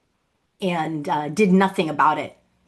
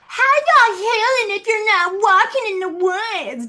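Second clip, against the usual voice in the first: very high, loud preachy mock voice; mocking impression; 14 semitones higher, 6 dB louder than usual. Mocking her sister's YouTube healing lecture in a shrill voice. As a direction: parody a smug online wellness guru.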